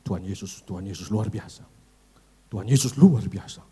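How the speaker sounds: low, weighty preacher voice; deep, put-on pulpit solemnity; bassy pulpit preacher. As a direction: imitate a preacher who goes bass at the pulpit. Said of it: "Kalau di mimbar agak ngebas".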